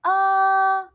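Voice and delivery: AH held high and flat, loud; long straight AH, high and loud; first tone. Shown as a demonstration. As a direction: sustain AH on one high pitch. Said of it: "let's say all four together".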